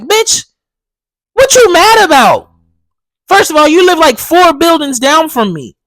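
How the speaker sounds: loud, high, heated telling off; snapping back at the eye-rolling neighbor, loud and high; angry retort. Retold or imitated. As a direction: let her have it, fed up.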